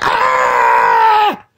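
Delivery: low short startled yell; quick mock scream of fright; imitated scream. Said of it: "scared me to death".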